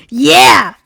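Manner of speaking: loud excited shout; loud cheer. Real, not acted. Cheering about the car sitting on the lot, loud.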